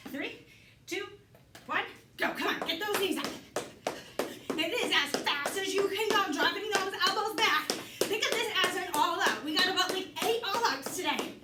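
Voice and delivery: upbeat fitness coach cueing; aerobics instructor. Acted. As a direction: pump up the class. Fitness coach counting down round.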